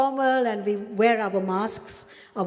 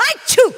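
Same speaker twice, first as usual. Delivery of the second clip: much higher, much louder achoo; loud fake sneeze; 14 semitones higher, 13 dB louder than usual. Shown as a demonstration. Teacher showing the children a loud sneeze. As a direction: sneeze it out big for the kids. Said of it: "very loudly".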